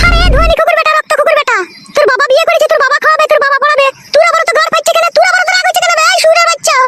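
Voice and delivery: high, relentless scolding; angry tirade. Acted. Wife berates husband over his father's marriage, angry high tirade. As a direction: pile up insults at a high pitch.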